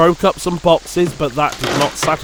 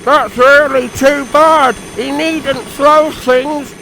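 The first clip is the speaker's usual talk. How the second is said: high, slow, indignant engine; 10 semitones higher, 4 dB louder, slower than usual. Acted. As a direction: protest indignantly. Percy indignant.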